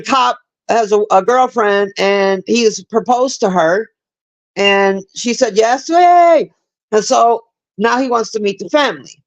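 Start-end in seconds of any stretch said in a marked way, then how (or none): 5.9-6.5 mock cheer. Celebrating the engagement.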